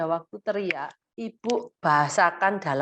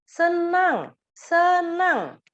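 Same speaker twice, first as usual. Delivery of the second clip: higher, slow "senang" modeled; labeling happy; 10 semitones higher, 4 dB louder, much slower than usual. Shown as a demonstration. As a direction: say senang clearly for the child.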